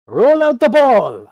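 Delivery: high, loud, drawn-out stage call; ringmaster's shout. Acted. Ringmaster calls for the ball before the crowd, showy shout. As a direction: belt it out slowly like a circus announcer.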